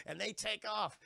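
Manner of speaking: normal talking voice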